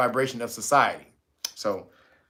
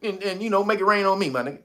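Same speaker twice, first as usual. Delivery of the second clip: high, wheedling pushy offer; mock America bribing; 6 semitones higher, faster than usual. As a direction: coax them to take the money. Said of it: "puts it in your pocket".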